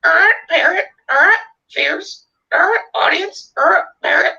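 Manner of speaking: very high squawking parrot. Acted. Fears's imagined parrot repeating words, high squawky parrot voice. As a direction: squawk each word out sharply. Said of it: "he would make it say".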